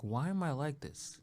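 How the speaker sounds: soft, slow sigh of a question; slow hushed self-scolding; quiet self-mocking. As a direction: mutter it to yourself, embarrassed. Retold self-talk.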